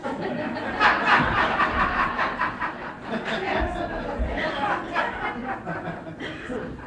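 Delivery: silent wheezing laugh at the punchline; unvoiced laugh; breathless laugh. Real, not acted.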